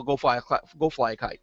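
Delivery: ordinary talk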